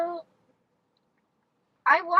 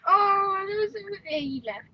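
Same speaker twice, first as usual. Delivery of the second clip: normal talking voice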